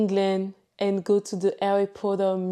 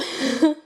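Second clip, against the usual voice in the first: quick bright giggle; short laugh; 7 semitones higher, 3 dB louder than usual. Real, not acted. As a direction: laugh lightly at yourself. Laughs at own remark.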